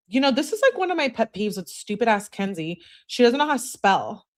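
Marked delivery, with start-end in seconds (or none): none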